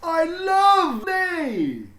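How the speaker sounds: high, loud and slow, booming with pride; a beaming principal proclaiming to everyone; proud headmaster announcing. A narrator's reading. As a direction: announce it grandly to the whole school. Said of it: "he said".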